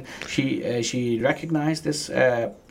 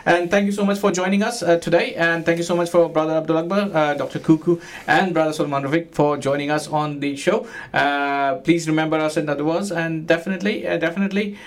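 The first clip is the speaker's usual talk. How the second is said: radio announcer; 5 semitones higher than usual. Acted. Radio host sign-off.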